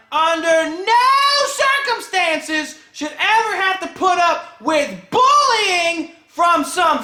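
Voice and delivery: real rage boiling over at a bully; getting mad. Real, not acted. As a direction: build to an angry, slowed-down yell. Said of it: "I'm getting mad right now".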